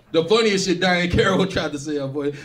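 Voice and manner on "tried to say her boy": normal talking voice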